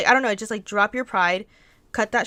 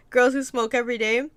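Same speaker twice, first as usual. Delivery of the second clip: ordinary talk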